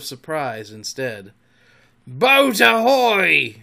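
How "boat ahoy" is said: long, loud, high sailor's hail; drawn-out pirate captain's bellow; ringing cry. A narrator's reading. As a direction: holler it across the lagoon. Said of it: "again came the cry".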